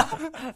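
high-pitched laugh; short high burst of laughter. Real, not acted. Joking about squid smell.

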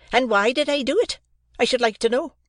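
put-on a harsh sister berating a boy; scolding sister. A narrator's reading. Mrs Joe exclaims.

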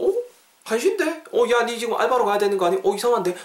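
high-pitched, hurried double-take; puzzled alarm. Acted. Noticing a friend should be at work, puzzled alarm. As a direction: question a friend in startled puzzlement.

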